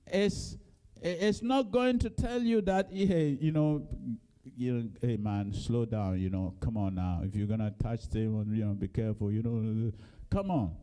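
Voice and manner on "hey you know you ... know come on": mock easygoing. Mock laid-back Jesus who never rebukes.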